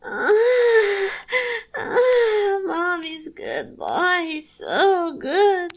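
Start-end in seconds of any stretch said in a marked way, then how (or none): 0.0-2.6 high, loud breathy moans. Acted. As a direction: let out rising moans. Moans rise in intensity.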